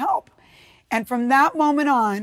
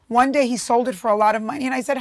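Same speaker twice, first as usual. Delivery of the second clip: normal talking voice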